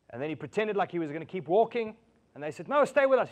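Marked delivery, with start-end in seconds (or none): 2.7-3.3 high, loud acted protest; urgent plea. Disciples to Jesus as he walks on, urgent plea. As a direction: burst out imploring him to stay. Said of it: "But they urged him".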